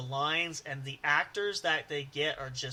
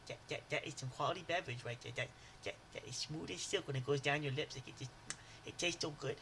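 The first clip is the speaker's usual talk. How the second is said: put-on much softer, fast, stammering Water Boy; Water Boy impression; 9 dB quieter, faster than usual. Praising any drink all week in a soft Water Boy voice. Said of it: "I mean".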